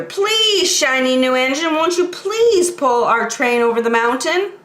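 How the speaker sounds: high, begging toy voices; high little-toy plea; dolls and toys pleading. Acted. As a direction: plead sweetly like a chorus of dolls. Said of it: "all the dolls and toys cried out together".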